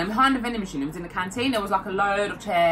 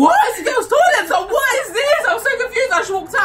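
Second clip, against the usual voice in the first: very high loud laughing disbelief; confused shout; 8 dB louder than usual. Retold or imitated. Sandra in the boys' toilet, high confused shout. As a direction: squeal it in total confusion.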